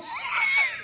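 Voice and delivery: a shrieking, very high burst of laughter; high laugh that breaks off; howling laugh. Real, not acted. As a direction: crack up wildly at the burn. Group cracks up at the burn.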